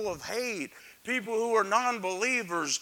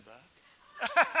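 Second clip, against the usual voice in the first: laughing; 4 semitones higher than usual. Real, not acted. Preacher laughs at heckle.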